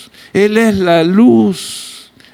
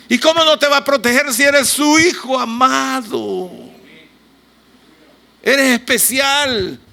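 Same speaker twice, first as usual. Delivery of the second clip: impassioned preaching; 7 semitones higher than usual. Real, not acted. Preacher exhorts the congregation.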